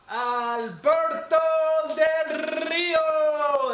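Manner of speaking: put-on drawn-out, loud, high Spanish-accented name call; slow, shouted Spanish-accent announcement. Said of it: "I had to say it in that kind of accent".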